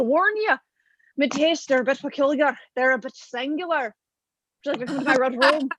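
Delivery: lightly voiced Irish leading man; a hint of a brooding seducer, played for laughs. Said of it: "And he's like".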